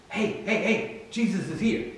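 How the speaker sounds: high, soft, urgent call; hushed summons. Acted. Martha fetching her sister, hushed summons.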